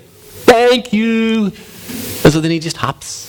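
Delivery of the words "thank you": high, stretched cartoon frog voice; wide-mouthed frog. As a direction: open wide and draw out the vowels. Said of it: "there's a wide-mouthed frog".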